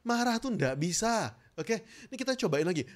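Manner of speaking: ordinary talk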